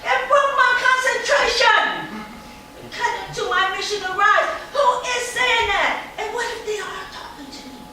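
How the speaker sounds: put-on high, loud, eager little girl voice; a proud, excited child talking fast; eight-year-old girl.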